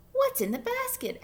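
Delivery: high little chick voice; tiny curious chick. A narrator's reading. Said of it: "asked Chick".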